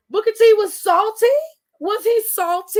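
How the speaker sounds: high, loud mock disbelief; teasing gasp of disbelief; mock surprise. Real, not acted. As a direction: act shocked he was salty.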